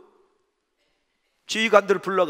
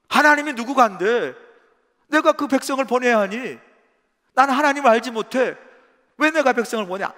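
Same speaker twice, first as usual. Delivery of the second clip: raised, louder mocking voice of a king; scornful pharaoh; 5 semitones higher, 6 dB louder than usual. Pharaoh scoffing at Moses, loud and mocking. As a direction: sneer at the request, louder and higher.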